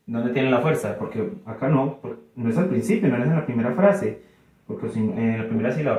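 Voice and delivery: normal talking voice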